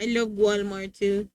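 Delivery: usual speaking voice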